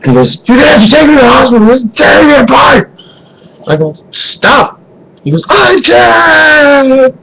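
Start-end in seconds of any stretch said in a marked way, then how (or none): 0.4-2.9 high, fast, strained wail; agonized dad. Retold or imitated. Dad on toilet begs son for hospital, agonized wail. 4.1-4.8 high, sharp stop; exasperated protest. Retold or imitated. Son to his wailing dad, exasperated. 5.5-7.1 high, drawn-out anguished cry; hammed-up wail of helplessness; agonized dad. Dad tells son he can't stop, anguished wail.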